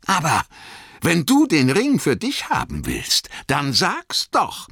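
high, angry challenge; Bilbo, rufend. A narrator's reading. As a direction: cry it out, dragging the last words. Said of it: "rief Bilbo". (zorniger Ausruf, hohe Stimme)

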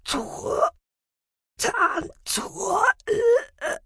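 very high, strained croak; broken, high-pitched fragments with long pauses. A narrator's reading. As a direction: struggle to push out each syllable. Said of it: "krächzte Sahira".

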